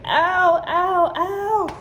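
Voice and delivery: high wincing plea to ease up; pained protest. Retold or imitated. Mother complaining to the doctors stitching her, high and wincing. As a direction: wince and beg them to go gentle. Can't reach, stitches hurt.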